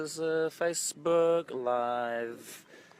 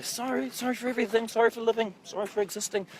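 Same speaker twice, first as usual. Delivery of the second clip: high, quick mock-polite sorrys; teasing impression of polite Australians; mock apologetic Australian; 9 semitones higher, faster than usual. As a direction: lampoon the over-apologising Aussie. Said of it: "They always say".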